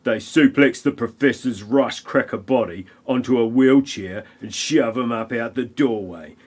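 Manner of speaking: ordinary delivery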